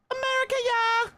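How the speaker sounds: very high, loud cheer; hammed-up high cheer; mock patriotic cheer. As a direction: whoop it like a cartoon fan. Repeats the cheer.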